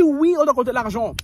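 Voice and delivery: high, loud mock uncle; hammed-up uncle answer. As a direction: confirm it in a pushy voice. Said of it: "Il dit".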